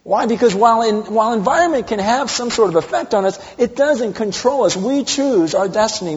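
loud, high preaching voice. Acted. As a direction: preach it out with full force. Preaching at full force.

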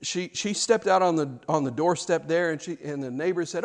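usual speaking voice

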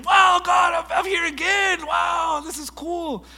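high, very loud thrilled cry; thrilled shout. Retold or imitated. Back in Hawaii, shouting thanks to God, high and loud. Second Hawaii trip awe.

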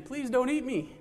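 high, small plea; pleading little boy. Acted. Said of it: "And Momotaro says, once again".

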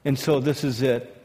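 ordinary delivery

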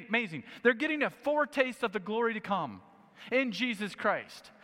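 normal talking voice

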